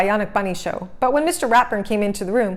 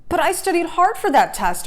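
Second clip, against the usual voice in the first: higher indignant protest; 10 semitones higher than usual. A narrator's reading. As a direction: defend yourself to doubting friends. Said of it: "Arthur protested".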